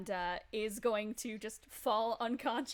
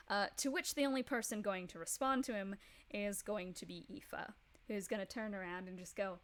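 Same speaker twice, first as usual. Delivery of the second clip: normal talking voice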